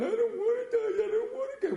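high wailing plea broken by sobs and laughter; terrified man wailing through tears; crying plea. Retold or imitated.

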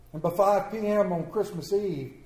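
plain reading voice

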